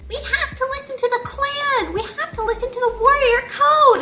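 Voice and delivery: very high, loud hammed-up squeal; Hollyleaf. Hollyleaf preaching the code to her clan, shrieky mock outrage. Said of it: "that's how it, she sounds".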